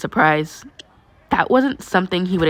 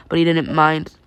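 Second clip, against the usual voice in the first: ordinary delivery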